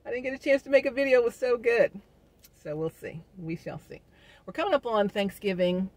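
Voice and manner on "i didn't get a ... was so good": excited gushing. Pretend. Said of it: "Be like".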